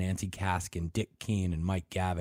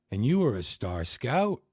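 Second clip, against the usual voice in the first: dolorously; 6 semitones higher, 5 dB louder than usual. Acted. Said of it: "Pat said dolorously".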